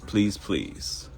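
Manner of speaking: ordinary talk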